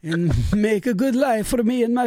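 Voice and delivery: high-pitched Italian immigrant grandpa; terrible Italian accent. Acted. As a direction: put on a hammy Italian accent. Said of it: "my terrible Italian accent".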